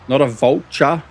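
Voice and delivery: vulture said as VOL-chuh, ch in the middle; mistaken word stressed. Shown as a demonstration. Vlogger answering a teasing viewer, emphatic VOL-chuh. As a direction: punch "vulture" with a ch sound. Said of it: "saying I was saying vulture".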